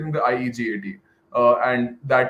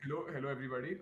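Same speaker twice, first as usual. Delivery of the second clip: trying to be heard, still faint; a bit louder but still faint; 15 dB quieter, much faster than usual. Real, not acted. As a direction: still quiet, pushing the voice up a little. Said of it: "Can you speak a bit louder?".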